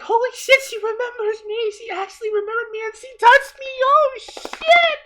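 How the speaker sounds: very high, squealing disbelief; high-pitched excitement building to a shout; starstruck freak-out. Retold or imitated. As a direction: re-enact a fan losing it. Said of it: "and I was like".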